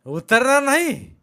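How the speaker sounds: high, loud, drawn-out refusal; defiant shout. A narrator's reading. Shepherd refuses Rama's order, defiant drawn-out shout. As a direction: dig in and yell it slowly.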